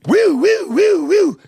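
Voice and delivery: high, loud police siren imitation; loud mock police siren whoops.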